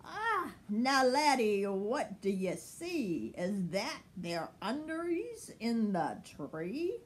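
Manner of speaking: lightly voiced Scottish man; a hint of a Highland brogue; cheery Scottish voice, light; Scottish accent.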